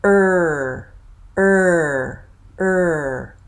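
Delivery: er with lips tight and tongue curled; tight er sound. Shown as a demonstration. Teacher modelling the R-colored er vowel. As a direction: hold a tense, curled r-colored er. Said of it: "My tongue is tight, curled".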